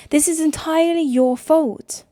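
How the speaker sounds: high, loud blame; accusing shout. Acted. Sister blaming Nick on the phone, loud. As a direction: pin it all on him.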